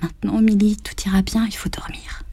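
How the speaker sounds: soft, whispered, fading to nothing; weak voice. A narrator's reading. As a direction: soothe her in a faint whisper. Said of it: "dit d'une voix faible". (voix faible et chuchotée)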